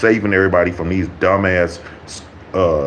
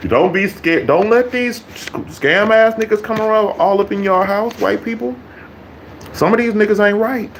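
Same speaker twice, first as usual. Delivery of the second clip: mock warning; 13 semitones higher than usual.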